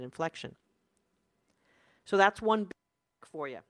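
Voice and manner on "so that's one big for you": usual speaking voice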